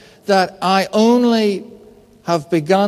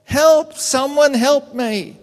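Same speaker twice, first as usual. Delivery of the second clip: high-pitched cry for help, drawn out at the end; half-hearted, high-pitched yell for help; mock yelling for help; 7 semitones higher, slower than usual. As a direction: act out a stranded man calling for rescue. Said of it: "he's yelling".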